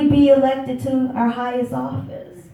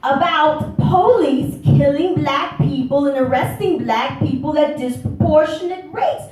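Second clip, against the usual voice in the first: higher-pitched pointed questioning; indignant challenge; 6 semitones higher than usual. Retold or imitated. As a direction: call them out, pitched up and emphatic.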